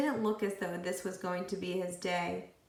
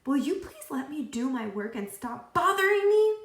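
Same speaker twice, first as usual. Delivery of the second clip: higher, louder exasperated plea; angry; 6 semitones higher, 6 dB louder than usual. A narrator's reading. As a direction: snap at her to leave him alone. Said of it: "Todd was very angry".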